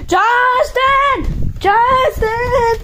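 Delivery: high calling shout across the house. Real, not acted.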